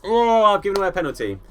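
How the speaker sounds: loud, high exclamations of dismay; dismayed exclaiming. Real, not acted. Streamer reacting to conceding a penalty, loud and high. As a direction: groan out loud at giving away a penalty.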